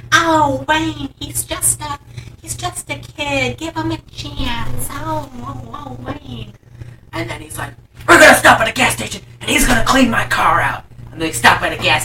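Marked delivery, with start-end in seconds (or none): 0.1-6.5 put-on high, soft, slow pleading girlfriend voice; hammed-up gentle pleading in a raised pitch; Kitty. Girlfriend begs the driver to spare the kid, mock soft pleading. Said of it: "and, and Kitty's like". 8.1-10.8 louder, higher imitation of an upset driver; hammed-up irate demand; mock angry order. Upset driver orders a car clean-up, mock angry order. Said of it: "he does get very upset".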